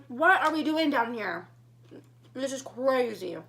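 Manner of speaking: reliving the panic at the icy road; alarmed disbelief. Retold or imitated. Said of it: "I'm like".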